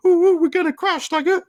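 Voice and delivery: high, loud, alarmed squeal of a fretting car; panicky car voice. Retold or imitated. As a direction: voice the car panicking over the car in front. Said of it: "the car had been like".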